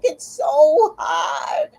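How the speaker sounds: long drawn-out high whine; whining about the heat. Retold or imitated. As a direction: stretch it out, high and laughing. Retold exchange about the heat.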